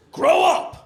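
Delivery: high-pitched mock-angry outburst; fed-up roommate, voice jumping up; exasperated retort.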